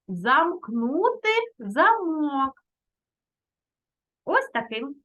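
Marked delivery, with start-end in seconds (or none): 0.1-2.6 «замкнути замок» розтягнуто, наголос на «замкнути»; вимовити «замкнути замок» повільно й чітко, як зразок; дуже повільно, підкреслено. Shown as a demonstration. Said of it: "Але доцільно". (повільно, з наголосом)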